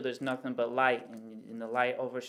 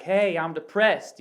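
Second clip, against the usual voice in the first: higher, louder, slow call across; mock yell; 4 semitones higher, 8 dB louder, slower than usual. Calling from the pool to people outside it, mock yell. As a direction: drag it out and yell it for attention. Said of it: "you're yelling at other people".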